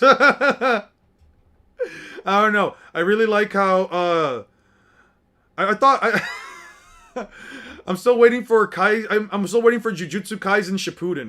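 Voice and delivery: loud, high laughing outburst; laughing and shouting. Real, not acted. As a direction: burst out through laughter.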